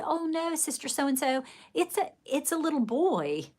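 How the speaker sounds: high, sweet, overly polite correction; mock-sweet reply to a scandalised elder; sugary correction. Correcting the old church lady, high and sugary.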